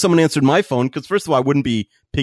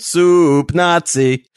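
announcer roll call; 2 semitones higher, 3 dB louder, much slower than usual. Pretend. Mock audience roll call.

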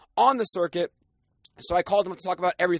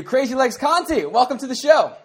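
higher, louder hyped guest intro; excited announcement; 6 semitones higher, 4 dB louder than usual. Real, not acted. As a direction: announce him like a big show host.

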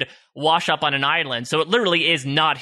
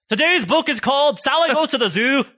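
put-on bright, high children's TV host; kids' show host; 10 semitones higher than usual. Mock Reading Rainbow host.

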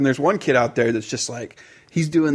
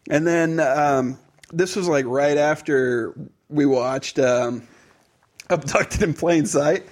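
ordinary delivery

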